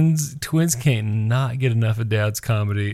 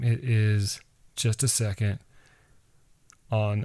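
ordinary talk

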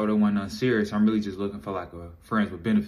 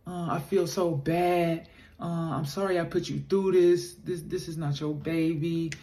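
higher, soft guilty confession in her voice; imitating a sorry girlfriend, higher and hushed; apologetic girlfriend; 6 semitones higher, 3 dB quieter than usual. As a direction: play the remorseful girlfriend, slowing at the end. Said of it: "she like".

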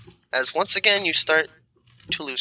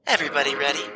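normal talking voice

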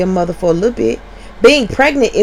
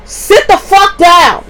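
slow, high, louder stern command; yelling at the kids; 10 semitones higher, 4 dB louder, much slower than usual. Retold or imitated. Mother pausing the podcast to order her sons, slow furious command. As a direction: bark it slowly to unruly boys, each word separate. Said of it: "I have to pause this and say".

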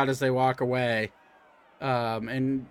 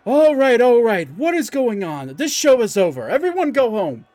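loud, high call to break up the crowd; shouting half elf; 8 semitones higher, 7 dB louder than usual. Acted. Half elf shouting at the crowd to go home, loud. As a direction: bellow over the noise to end the show. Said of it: "It's like".

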